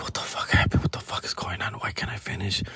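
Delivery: whispered, baffled to himself; bewildered whisper. Retold or imitated. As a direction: whisper it in disbelief in bed. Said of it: "be like".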